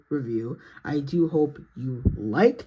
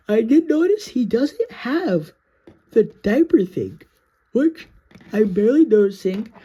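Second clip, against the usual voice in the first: excited squeal; 6 semitones higher, 5 dB louder than usual. Real, not acted. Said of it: "I'm so happy about".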